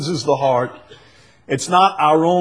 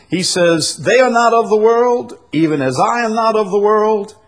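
high, raised scripture proclamation; forceful preaching; 10 semitones higher, 5 dB louder than usual. Acted. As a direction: declaim the verse to the congregation.

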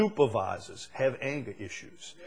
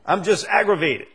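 quoted excuse; 9 semitones higher, 7 dB louder than usual. Retold or imitated. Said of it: "like people have told me".

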